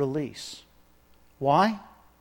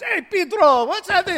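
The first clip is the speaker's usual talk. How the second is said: much higher, louder playful hello; hammed-up greeting; 12 semitones higher, 5 dB louder than usual. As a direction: toss it out like a joking call to a friend. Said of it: "and it was".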